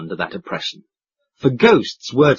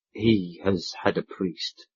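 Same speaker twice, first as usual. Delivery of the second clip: softer, lower and slow, moved; touched; 6 semitones lower, 9 dB quieter, slower than usual. A narrator's reading. Proprietor tells the men of the death, moved. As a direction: say it gently, shaken. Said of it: "not untouched".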